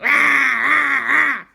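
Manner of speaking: high, loud imitated raptor screech; velociraptor roar. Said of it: "the velociraptor was like".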